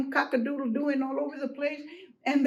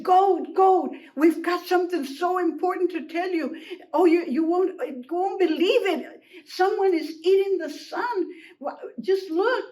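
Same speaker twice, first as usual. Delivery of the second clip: high, breathlessly eager to tell; urgent alarm; 5 semitones higher, 3 dB louder than usual. Acted. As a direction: plead to be believed. Urgent call to Goat.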